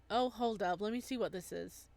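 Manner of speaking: usual speaking voice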